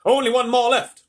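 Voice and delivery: loud, high headline cry; high-pitched newspaper shout; screaming headline. Acted. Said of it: "screamed the headlines".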